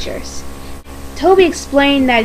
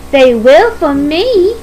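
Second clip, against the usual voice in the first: slow, higher, proud boast; 4 semitones higher, 3 dB louder than usual. Acted. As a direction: boast it, drawing the words out. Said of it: "said Daisy proudly".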